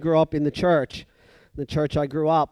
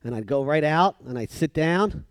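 usual speaking voice

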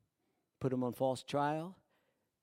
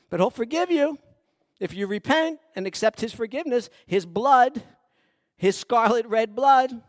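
loud, higher, rising preacher's voice; preaching at full force; 7 semitones higher, 16 dB louder than usual. Acted. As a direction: build it up like a revival sermon. Preaching the apostles' witness.

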